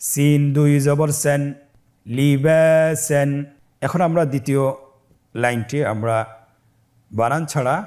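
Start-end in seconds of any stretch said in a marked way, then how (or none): 0.1-3.3 chant-like spelling drill, long vowels held steady; measured recitation for pupils to repeat. Shown as a demonstration. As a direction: spell each syllable, then join the word slowly. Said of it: "আমার সাথে বানান করে বলুন".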